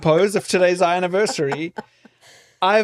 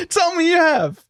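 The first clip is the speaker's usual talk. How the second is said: normal talking voice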